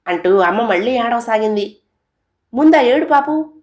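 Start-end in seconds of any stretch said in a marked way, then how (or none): 2.5-3.5 sharp, high, loud rebuke; a father's angry order, raised and high; angry scolding. Acted. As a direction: snap at her to stop crying. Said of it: "అంటూ కోప్పడ్డాడు నాన్న".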